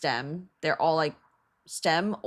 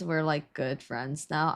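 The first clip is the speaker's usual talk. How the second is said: usual speaking voice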